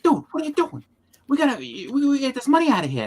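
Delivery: a hint of a panicked partner in crime; mock urgent partner. Voicing Breaking Bad character.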